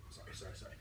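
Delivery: very quiet, under-the-breath apology; hushed sorry after breaking off; muttered apology. Real, not acted. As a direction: toss off a barely audible apology.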